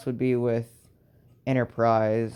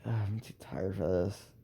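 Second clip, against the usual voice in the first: much softer and lower, worn out; exhausted groan; 4 semitones lower, 10 dB quieter than usual. Acted. Tired boy too exhausted to get up, softly groaning. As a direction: sag into the words, spent. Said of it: "but he's like".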